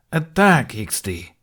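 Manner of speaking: sharp shouted command; called-out order. A narrator's reading. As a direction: bark the order across the yard. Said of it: "Domokos instructed from somewhere out of sight".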